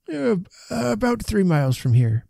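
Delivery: put-on high, halting old man's voice; timid old rustic, pitched well up; hesitant old man. A narrator's reading. Old Timmins voiced, answering Joe.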